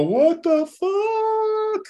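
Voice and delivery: high, drawn-out shocked exclamation; stunned disbelief. Retold or imitated. Coach retelling a paralyzed baby handed over, drawn-out shocked cry. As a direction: stretch it out in pure disbelief.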